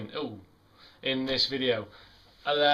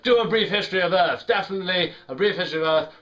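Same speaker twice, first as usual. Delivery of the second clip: louder imitation of an enthusiastic friend; eager urging; 4 semitones higher, 5 dB louder, faster than usual. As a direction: urge it on with mock eagerness. Acts out friend's eager advice.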